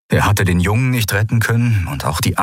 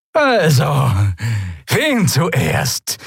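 high, rasping scornful voice; rasping sneer; 7 semitones higher, much slower than usual. Acted. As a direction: rasp it out with contempt. Draxyl taunts prisoners.

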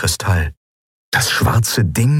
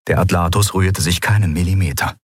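usual speaking voice

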